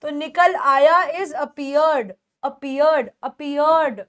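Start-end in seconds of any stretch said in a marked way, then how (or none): none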